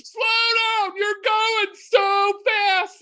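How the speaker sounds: high, slow mock scream; imitated scream. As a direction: scream it slowly like a terrified passenger. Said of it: "starts screaming at the top of their lungs".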